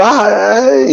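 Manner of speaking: high, frightened little ay ay; mock frightened cry. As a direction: squeal like a scared seminarian. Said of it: "el otro". (quejido asustado muy agudo)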